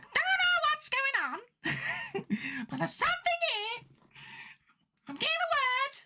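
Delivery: very high, drawn-out comic medium voice; Clinton Baptiste. Shown as a demonstration. Streamer plays a comedy medium sensing something, squeaky impression. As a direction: squeal it like a hammed-up psychic getting a message. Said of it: "Clinton Baptiste".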